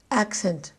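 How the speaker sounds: "Accent" as AC-cent, the verb just like the noun; stress on first syllable. Shown as a demonstration. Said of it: "There was no difference".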